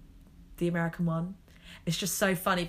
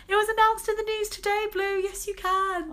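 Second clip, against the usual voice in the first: much higher, squealing delight; excited squeal; 5 dB louder than usual. Retold or imitated. Scene: telling the queen Blue she can marry, high squeal. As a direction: squeal the good news up high.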